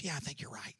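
hushed, unvoiced agreement; retold whisper. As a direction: whisper it back to your friend, retelling the moment. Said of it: "it wasn't a whisper".

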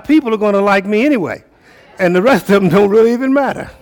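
full-force preaching. Acted. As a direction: lift them up from the pulpit, voice soaring.

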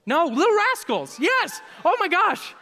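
very high, loud exclamations; excited outburst. Real, not acted. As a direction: cry out in playful exasperation. Playful reaction to audience movie guesses.

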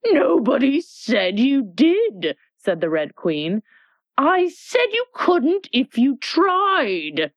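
high, slow, impatient scolding; impatient Red Queen. Acted. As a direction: lecture the child sharply.

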